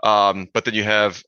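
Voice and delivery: ordinary delivery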